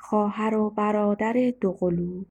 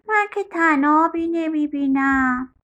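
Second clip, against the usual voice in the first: lightly voiced doubting boy, higher pitch; little boy; 6 semitones higher than usual. A narrator's reading. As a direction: object like a puzzled small child. Boy twin objects in a story.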